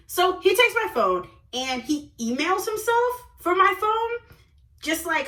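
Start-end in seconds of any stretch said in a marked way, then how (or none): none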